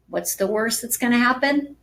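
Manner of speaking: ordinary delivery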